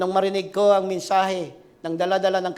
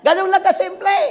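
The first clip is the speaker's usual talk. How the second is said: high, loud preacher's shout; fiery preaching; 10 semitones higher, 8 dB louder than usual. Acted. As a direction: rouse the congregation. Preaching climax. (malakas na sigaw ng mangangaral)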